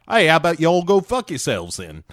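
mock Australian snap. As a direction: fire it off in an Aussie accent. Kidman telling them off.

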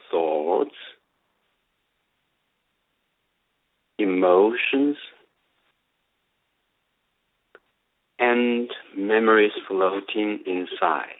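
guided meditation. Acted. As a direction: lead them slowly into stillness. Leads a meditation.

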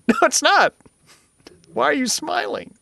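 very high, squeaky dismay; hammed-up horrified candy victim; mock distressed protest. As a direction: object in a squeal of betrayed disbelief. Eater protests at cheerful candy giver.

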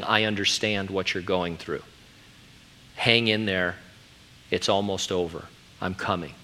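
low, soft comforting voice; tender reassurance. Acted. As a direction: console someone who is suffering, gently. Voices Jesus comforting the suffering.

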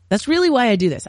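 normal talking voice

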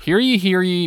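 town crier. Acted. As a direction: announce it like a herald. Back from Renaissance fair.

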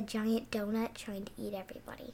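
hushed breathy whisper. Real, not acted. Girl telling her donut nightmare, whispered. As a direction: whisper it like a secret. Whispered spooky dream detail.

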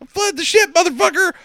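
high-pitched hammed-up demand; imitated frantic order; mock furious threat. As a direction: shriek it like a movie hero losing it. Acting out the hero's frantic threat.

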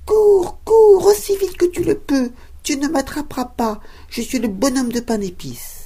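high, loud sing-song taunt; cheeky cookie shouting back over his shoulder; gingerbread man taunting. Acted. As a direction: tease them from a safe distance, high and bright. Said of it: "en criant".

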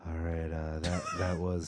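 low, quiet, dull radio DJ; sleepy radio DJ. Retold or imitated. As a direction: mumble like a bored late-night DJ. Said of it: "D Js on there that are like".

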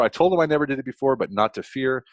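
normal talking voice

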